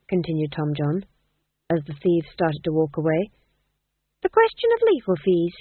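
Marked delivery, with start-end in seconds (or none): 4.2-5.6 high-voiced, smooth polite refusal. A narrator's reading. Tom John politely declining the thieves' offer, high and smooth. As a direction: decline smoothly, pitched well up. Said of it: "said Tom John smoothly".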